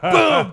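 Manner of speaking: high, loud exploding boom; slam sound effect. Retold or imitated. Storyteller as the whole house locks down, loud slam effect. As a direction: punch out a big impact noise. Imitates doors slamming in brawl.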